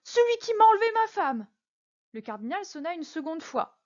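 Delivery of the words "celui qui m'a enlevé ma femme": much higher, loud outburst of recognition; startled cry. A narrator's reading. Prisoner recognising his wife's kidnapper, high startled cry. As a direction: blurt it out in shock. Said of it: "s'écria Bonacieux".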